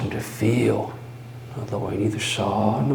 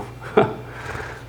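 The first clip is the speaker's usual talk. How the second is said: mad chuckle; 3 dB quieter than usual. Acted. Madman's chuckle.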